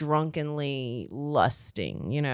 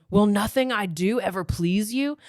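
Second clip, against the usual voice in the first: raised but restrained frustration; exasperated hero; 2 semitones higher than usual. Acted. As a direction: voice the hero, fed up with her. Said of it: "I got in the wrong person".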